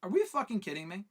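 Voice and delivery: usual speaking voice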